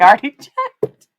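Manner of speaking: high, loud mock protest breaking into laughter; exasperated retold reply. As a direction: protest loudly, laughing through the retelling. Retelling her exasperated reply to the kid.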